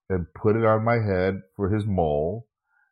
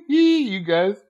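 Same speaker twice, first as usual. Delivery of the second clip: laughing; 12 semitones higher than usual. Real, not acted. Cracking up recalling funny episode.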